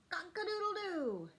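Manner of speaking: high crowing cock-a-doodle-doo; rooster crow. Pretend.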